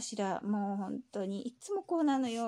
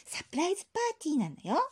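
ordinary talk

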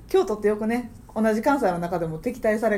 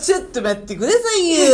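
mock comedian's loud protest; 周りに聞かせる大声; 5 semitones higher, 5 dB louder than usual. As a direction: overdo it like an attention-seeking student. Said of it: "絶対に周りを意識した音量で". (芸人気取りの大げさな大声)

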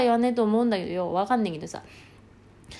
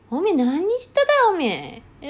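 high, loud opening that drops away; mock accusing dialect; 6 semitones higher, 5 dB louder than usual. Elder asking someone what they did, mock-accusing country dialect. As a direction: demand an answer in rural dialect.

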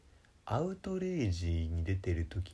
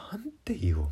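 high, slightly louder panicky inner voice; mock panic, pitched up; flustered thought; 12 semitones higher, 4 dB louder than usual. Panicking about what to say.

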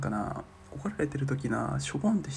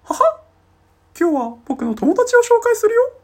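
very high, loud squeaky falsetto greeting; hammy cartoon mouse host, way up high; squeaky mascot falsetto introducing a friend; Mickey voice; 16 dB louder than usual. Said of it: "もう一回".

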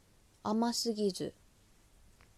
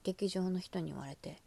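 ordinary delivery

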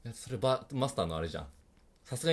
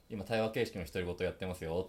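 muttered self-talk; 6 semitones lower, 6 dB quieter than usual. Shown as a demonstration. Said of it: "独り言で言ってんの".

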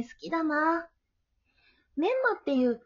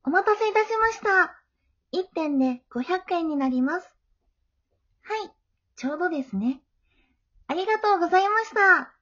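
higher, louder bright service voice; polite shop clerk; 4 semitones higher, 5 dB louder than usual. Acted. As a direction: greet the customer like a cashier at the register. Bookshop clerk serves customer. (店員らしい高く明るい接客の声)

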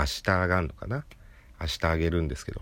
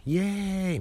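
mock party cheer; slower than usual. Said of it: "みたいな感じなんですよ".

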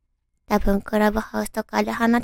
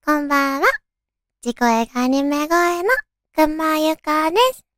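bright raised anime-style voice; perky radio host in anime voice; high cute anime girl; 6 semitones higher, 4 dB louder than usual. Acted.

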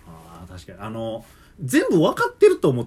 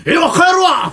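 much higher, louder walkout; huffy shout; 14 semitones higher, 9 dB louder than usual. Retold or imitated. Customer leaving angrily over points card, huffy shout.

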